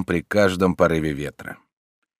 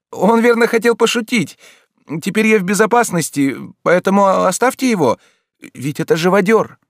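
much higher and louder, calling from upstairs; girl calling down; 13 semitones higher, 6 dB louder than usual. A narrator's reading. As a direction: shout down the stairs thankfully. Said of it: "сверху закричала". (девушка кричит сверху, очень высоко)

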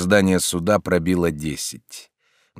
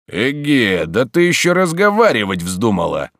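higher and louder, bullying yell; angry shout; 4 semitones higher, 5 dB louder than usual. A narrator's reading. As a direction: bark at her for talking back. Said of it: "закричал живодёр". (грубый окрик, громче и выше)

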